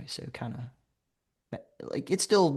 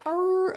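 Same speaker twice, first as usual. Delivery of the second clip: high, sustained mimicked howl; long high "arooo"; imitated howl. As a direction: howl playfully like a coyote. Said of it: "And she, like".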